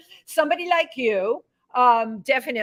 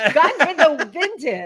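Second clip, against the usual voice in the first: Gone with the Winden in a lightly put-on German accent; host echoing the guest's German accent; mock German accent; 3 semitones higher than usual. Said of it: "The German accent".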